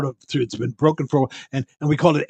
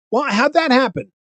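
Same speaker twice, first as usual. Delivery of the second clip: nosy fairgoer; 10 semitones higher, 3 dB louder than usual. Retold or imitated. Nosy fairgoer keeps asking.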